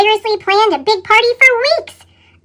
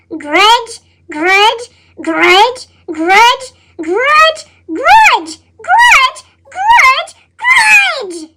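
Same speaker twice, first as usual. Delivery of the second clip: each grudge angrier until you yell; building to a shout; 3 semitones higher, much slower than usual. Acted. Fury builds over missed party.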